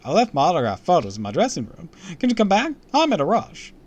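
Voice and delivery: loud, high-pitched showman voice; hurried TV host. Acted. Host asks fans to come back later, loud and hurried. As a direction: play a brash host rushing off.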